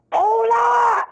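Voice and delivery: high, loud yell across the prison; bellowing shout. Retold or imitated. Retelling Charlie bellowing for her, loud shout. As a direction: bellow her name like a hard man.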